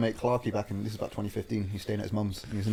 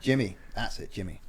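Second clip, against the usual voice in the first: ordinary talk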